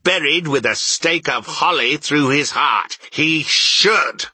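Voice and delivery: high, rising indignant tirade. A narrator's reading. Scrooge ranting at merry Christmas idiots, indignant and high. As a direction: build the outrage to a loud finish. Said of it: "said Scrooge indignantly".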